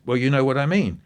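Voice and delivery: mock a man brazening it out; toughing it out. Radio guest toughs out the host's challenge, imitated brazen defiance. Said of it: "he kind of toughed it out and said".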